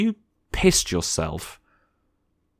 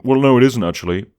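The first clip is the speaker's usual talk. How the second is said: higher, louder, know-it-all objection; mock a fussy fan correcting the film; sneering mock of a nitpicker; pedantic nitpicker; 5 semitones higher, 7 dB louder, faster than usual.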